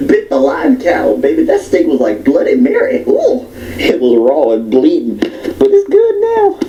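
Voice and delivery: high, slow complaining diner; fussy woman complaining. Retold or imitated. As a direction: whine about the raw steak, high. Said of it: "She said".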